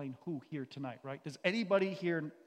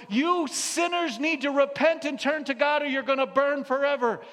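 loud, higher mocking preacher shout; mock hellfire pastor; 9 semitones higher, 12 dB louder than usual. Mocking a pastor in an online video, loud parody. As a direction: parody a pastor yelling repent. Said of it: "This pastor's up there going".

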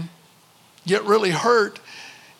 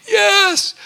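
put-on high, louder child's answer; small boy's yes; 11 semitones higher, 5 dB louder than usual. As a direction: say yes like a little boy. Boy answering father.

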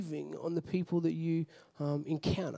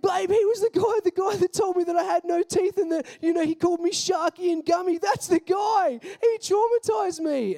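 much higher, louder tipsy shout; drunk excited shout; 10 semitones higher, 4 dB louder than usual. Retold or imitated. Tipsy guest shouting to girlfriend, much higher and loud. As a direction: call his girlfriend over, thrilled. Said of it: "he goes".

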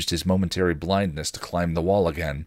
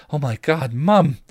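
rising, louder alarmed cry; cried out; 7 semitones higher, 5 dB louder than usual. A narrator's reading. Buffy crying out as she sees her mother, alarmed. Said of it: "she cried".

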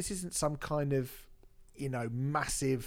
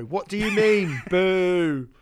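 loud, higher heckle from the crowd; mock heckling shout; 5 semitones higher, 10 dB louder, slower than usual. Fan heckling the singer at a concert, loud mock boo. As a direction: boo her like a cheated fan. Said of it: "go and see [name]".

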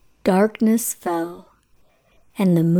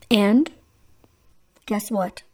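ordinary delivery, nothing marked

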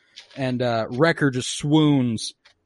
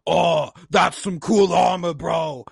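big slow bellow of fake admiration; Wrecker; 3 semitones higher, slower than usual. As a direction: shout it drawn out, like a cocky oaf. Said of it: "Wrecker going up to Darth Vader and being like".

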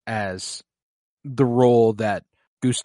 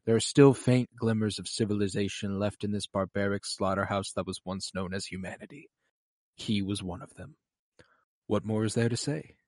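softer, sadder reading of the line; a hushed, much softer retelling of Zero's farewell words; quiet wistful quote; 3 semitones lower, 8 dB quieter than usual. Retold or imitated. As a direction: let it fade, remembering a lost friend. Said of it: "he looks back on Monsieur Gustave and he goes".

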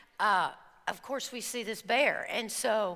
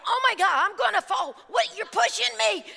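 panicked; 9 semitones higher, 5 dB louder, faster than usual. Retold or imitated. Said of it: "I'm like".

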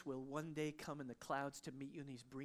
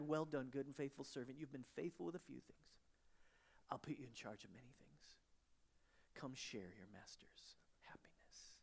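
soft, fading into a whisper; 3 semitones lower, 6 dB quieter than usual. Acted. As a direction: let the master's praise sink to a hush. Master's words read, fading.

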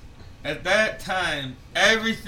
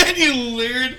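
laughing; 8 semitones higher, 9 dB louder than usual. Real, not acted. Teasing his friend, bursting out laughing.

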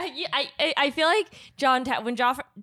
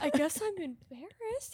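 much softer, sheepish mumble; meek mumble; 4 semitones higher, 12 dB quieter than usual. Retold or imitated. Imitating the embarrassed bar guy, soft sheepish mumble. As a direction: shrink into the words like a scolded guy. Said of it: "And the guy's like".

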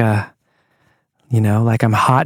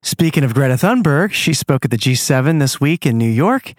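ordinary talk, nothing marked

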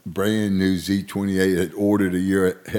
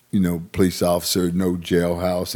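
usual speaking voice

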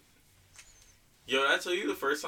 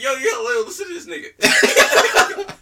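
loud hype call; 12 semitones higher, 15 dB louder, slower than usual. Retold or imitated. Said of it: "I'd be like".

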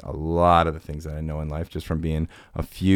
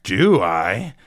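drawn-out, louder, higher teasing question; mock mystery; 5 semitones higher, 6 dB louder, much slower than usual. Host doubting he knows the emailer, slow mock mystery. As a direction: stretch it out as a playful reveal.

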